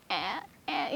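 small, softly imitated baby's cry; cute baby cry. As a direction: mimic a newborn's cute little wail, quietly. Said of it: "this really cute cry".

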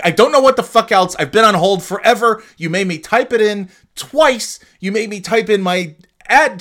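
reliving the rage at the bank, yelling; furious yell. Retold or imitated. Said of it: "I'm reliving it now".

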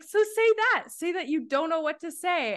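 high, insistent nudge; replaying her own push; retold urging. Retold coaching.

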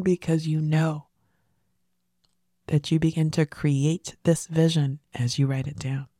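normal talking voice

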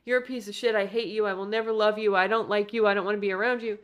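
normal talking voice

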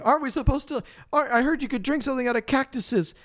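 high mock eager voice; high silly voice; squeaky hopeful voice. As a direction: pitch up like a desperate idea. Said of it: "Bobby's like".

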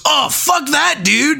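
high, mocking stoner voice; mock dismissive dude. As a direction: toss it off like a clueless dude. Mimicking the guy's dismissal.

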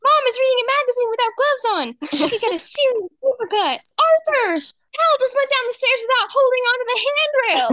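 put-on high, loud little girl calling out; shrill mock child yell of Mom; imitating a bossy little sister; tattling kid. D W tattling on everyone.

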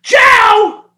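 very high, loud mock wail; imitating screaming lady. Lady complaining to Joe about the quitting worker, shrieking mock outrage. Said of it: "And she goes".

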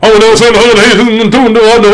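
cheerful protest; excited no. Real, not acted. Excited denial of funny video.

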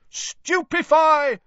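high, loud, slow spell cry; shouted spell. A narrator's reading. Keepers casting the spell together, loud slow shout. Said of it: "they shouted in unison".

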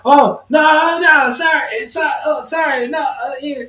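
high, flustered broken-English act; feigned confusion, fake accent. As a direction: play dumb, pretend not to understand English. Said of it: "pretend he didn't understand English".

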